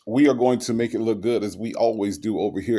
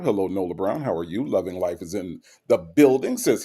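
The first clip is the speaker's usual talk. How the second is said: ordinary delivery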